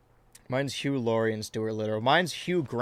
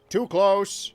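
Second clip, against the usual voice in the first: sudden louder, higher yelp of protest; startled exclaim; 8 semitones higher, 7 dB louder than usual. Real, not acted. Gamer protesting the camera zoom, startled shout. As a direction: blurt it out, caught off guard. Camera zoomed in too far.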